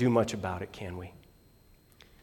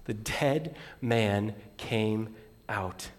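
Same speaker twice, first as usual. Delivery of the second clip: ordinary talk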